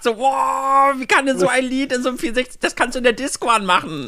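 high, fast, thrilled wow; amazed. Retold or imitated. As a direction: gush like a stunned teen fan. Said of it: "Ich hab damals so gedacht".

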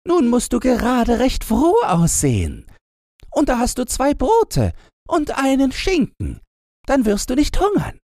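put-on high, brash young robber girl; lively high-pitched girl voice. A narrator's reading. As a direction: play the bold little robber girl, high. Robber girl continues speaking.

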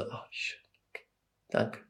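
very soft, whispered thanks; peaceful gratitude. Retold or imitated. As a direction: sigh it out barely audibly, at peace. Acts out peaceful gratitude in bed. (friedlich gehauchter Dank)

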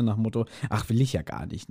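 normal talking voice

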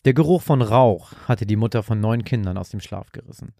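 ordinary delivery

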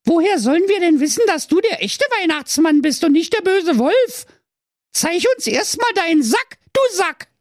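put-on very high, fast cheeky kids; mocking goat kids; sly kids. A narrator's reading. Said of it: "riefen listig".

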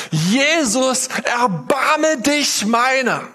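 high, slow, drawn-out plea shouted to Jesus; desperate cry. A narrator's reading. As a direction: cry out for mercy with all the voice you have. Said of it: "Er fängt an zu schreien, so laut er kann, mit voller Stimme".

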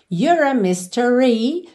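"mystery" slow, final "ree" stretched; long last syllable. Shown as a demonstration. Teacher models the lyric, lengthened last syllable. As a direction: draw out the end of "mystery" as in the song. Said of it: "essa última nota acaba sendo um pouquinho mais longa".

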